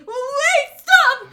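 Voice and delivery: high, loud frantic cry; panicked plea. Acted. Orochi begging Hiyori to halt, panicked. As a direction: shriek it in mock terror. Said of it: "Orochi's saying".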